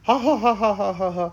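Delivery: loud, high, hammed-up ha-ha laugh; teacher showing the class what laughing sounds like; demo laughter. Shown as a demonstration. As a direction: belt out a big show laugh. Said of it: "मैं हंस रहा हूँ".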